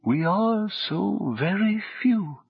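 slow, soft, almost feminine tones; drawn-out, high, gentle sorrow; sad high voice. A narrator's reading. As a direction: lament softly in a high voice. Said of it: "it went on".

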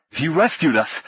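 winded; gasped. A narrator's reading. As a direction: gasp it out while catching breath. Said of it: "Paul gasped".

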